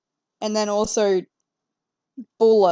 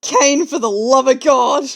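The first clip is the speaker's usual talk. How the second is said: louder cry at the friend; exasperated; 3 semitones higher, 9 dB louder than usual. Real, not acted. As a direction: groan out his name in disbelief.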